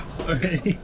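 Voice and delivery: high "sorry" between bursts of laughter; laughing apology. Real, not acted. Saying sorry after a sudden mishap, laughing. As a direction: apologise while cracking up.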